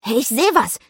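loud, high excited cry; sudden call. A narrator's reading. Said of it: "rief er plötzlich". (plötzlich aufgeregt gerufen)

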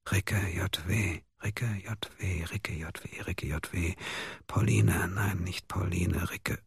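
breathy near-silent whisper, slow and drawn out. Acted. Mia alone on the rock, whispering the clue over and over. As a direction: murmur the letters to yourself like a half-remembered code. Half-asleep muttering code.

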